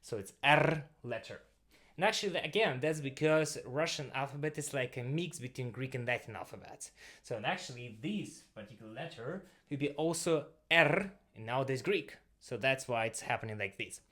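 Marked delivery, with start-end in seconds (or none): 0.4-0.8 "R" as trilled Russian err; rolling R. Shown as a demonstration. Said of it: "it's a rolling R". 10.7-11.1 "R" as trilled Russian err; rolling R. Shown as a demonstration. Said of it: "it's a rolling R".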